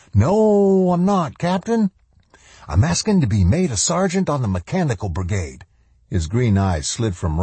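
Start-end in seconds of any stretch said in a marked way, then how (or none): 0.1-5.6 high-pitched, swooping brash upstart; cocky intruder. Acted. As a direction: push the voice up high, cocky and eager. Mangrum, a cocky intruder answering the captain.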